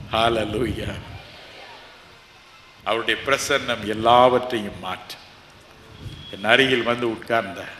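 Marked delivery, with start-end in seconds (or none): none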